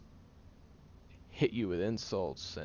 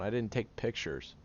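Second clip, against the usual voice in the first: ordinary talk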